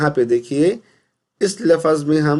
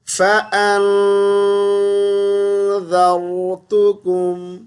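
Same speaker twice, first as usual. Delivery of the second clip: Quran recitation; 3 semitones higher, much slower than usual. Acted. Teacher recites the next verse melodically. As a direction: recite slowly with a drawn-out tune.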